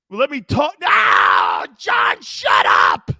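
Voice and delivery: loud, drawn-out yell to shut someone up; shouted shutdown. Acted. Cutting off John in an argument, long loud shout. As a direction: bellow over the other person, stretching the words.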